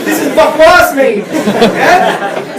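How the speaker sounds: loud, laughing street-lad jab; laughing street slang. Acted. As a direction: tease the posh crowd through a laugh. Street slang to student crowd.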